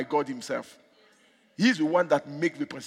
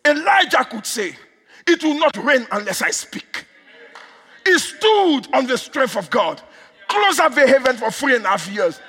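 emphatic, ringing sermon delivery; raised-voice preaching; 8 semitones higher, 8 dB louder than usual. Real, not acted. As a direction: drive each statement home from the pulpit.

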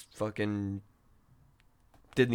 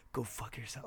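quiet retold whisper, much softer; 12 dB quieter than usual. At a desk, hanging up on a scam caller in a whisper. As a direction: hiss it under your breath at your desk. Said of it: "whispered at my desk".

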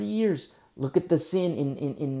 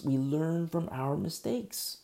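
ordinary delivery

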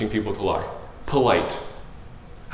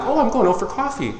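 high, chirpy, lightly acted; mock bright hint; 10 semitones higher than usual. Preacher acting a friend hinting at coffee, high chirpy. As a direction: sing it out like dropping a hint. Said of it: "I'm like".

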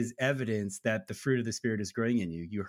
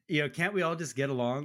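normal talking voice

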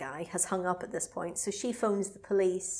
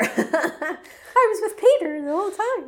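much higher, louder put-on voice; mock innocent alibi; 10 semitones higher, 9 dB louder than usual. Mocking the girlfriend's alibi, high and put-on. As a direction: play the sweet innocent.

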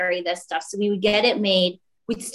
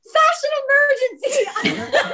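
gate woman; 4 dB louder, faster than usual. Retold or imitated. Gate woman declaring, high and laughing. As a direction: ham up the gate woman's cry. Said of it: "the woman goes".

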